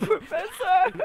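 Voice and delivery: high, loud, drawn-out wail; anguished cry. Pretend. Student sees professor hit, anguished cry. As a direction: cry out for a fallen friend, stretched long.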